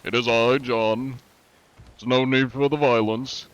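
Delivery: a hint of a haughty gentleman doing inspections; posh inspector voice. Shown as a demonstration. Streamer shows chat his lightly posh inspection voice. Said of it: "talk more posh".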